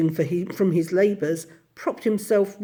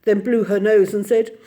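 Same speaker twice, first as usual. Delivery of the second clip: ordinary delivery, nothing marked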